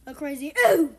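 loud crazy ooh sound; hard landing oof. Shown as a demonstration. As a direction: belt out a big oof. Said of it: "I know how to make the oof sound".

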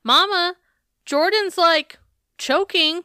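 high-pitched imitated kid calling out; mock yell. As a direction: call for mama in a high voice. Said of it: "suddenly Jamesy Bug yells".

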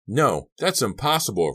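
high, loud outburst; disbelieving cry. A narrator's reading. Boy hearing his gun was left, crying out. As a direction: cry out in denial. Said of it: "Ricky cried".

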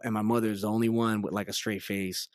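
ordinary delivery

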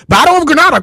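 loud, high mock chant of the battle name; mocking Moors. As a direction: parrot the tired refrain, exasperated. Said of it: "I was so tired of Moors talking about".

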